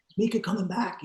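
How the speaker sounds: imitating an eager boss, pitched high; excited. Boss asking if Mika is returning, excited. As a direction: lift the voice high in hopeful excitement. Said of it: "he was all excited to see her".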